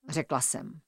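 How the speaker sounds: ordinary delivery, nothing marked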